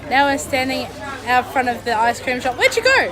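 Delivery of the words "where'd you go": loud, high call across the street; high, loud call to a missing friend. Real, not acted. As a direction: shout to find someone who wandered off. Calling out to Michelle outside.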